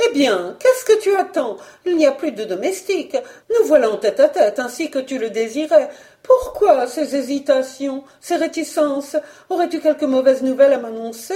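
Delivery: high, quick, impatient questioning; nervous pressing. Acted. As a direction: press your husband for answers. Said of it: "Déjà très nerveuse ... Eudoxie le pressait".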